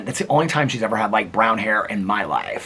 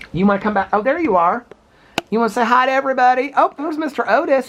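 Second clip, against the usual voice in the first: much higher sing-song hello to the dog; 11 semitones higher than usual. Real, not acted. Coaxing the dogs to camera.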